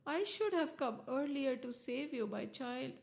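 very soft, tender apology; fatherly tenderness. A narrator's reading. Narasimha says sorry to young Prahlad, fatherly and hushed. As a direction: speak gently, as to a beloved child. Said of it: "There was a fatherly look".

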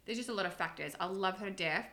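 ordinary delivery

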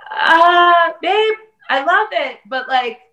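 high, loud hesitant dad voice. Retold or imitated. Dad gently objecting to the post, high and hesitant. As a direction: hem and haw as the uneasy father.

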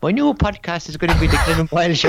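embarrassed attempt at Irish; Irish accent. Shown as a demonstration. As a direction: have a go at a put-on Irish accent. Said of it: "can you do an Irish accent".

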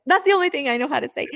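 high, loud, fast flustered blurt; blurting to her husband in panic at not knowing Spanish, high and fast; panicked helpless look. Retold or imitated. Retelling her panic at the villagers, high-pitched and rushed. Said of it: "I'd look at my husband like".

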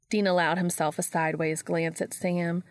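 usual speaking voice

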